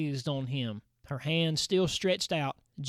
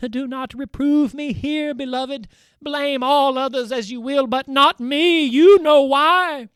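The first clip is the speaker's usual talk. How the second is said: higher, louder pleading voice, rising to a cry; pleading wife; 7 semitones higher, 8 dB louder than usual. A narrator's reading. Undine entreating her husband, higher, pleading. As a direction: plead with him not to blame her. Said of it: "softly murmuring to herself ... entreat her husband".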